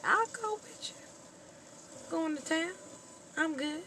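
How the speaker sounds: put-on high breathy girlish voice; eager little girl. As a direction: chirp it like a keen young daughter. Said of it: "And the daughter".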